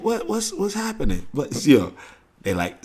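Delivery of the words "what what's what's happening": mock confused relatives.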